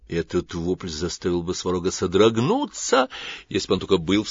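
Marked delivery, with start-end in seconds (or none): none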